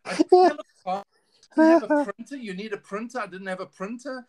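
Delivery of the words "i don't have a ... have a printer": high, loud laughing repeat; laughing exclamation. Real, not acted. [name] admitting to his friend he had nothing, loud laughing repeat. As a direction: shout it out through laughter.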